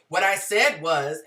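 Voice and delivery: mock condescending repeat; patronizing repeat. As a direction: spell it out like they are hard of hearing. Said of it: "as though I was hard of hearing or slow".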